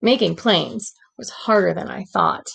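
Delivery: gentle read-aloud storytelling voice; story narration. A narrator's reading. Teacher reading a storybook to the class, gentle narration. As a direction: read it like a bedtime picture book.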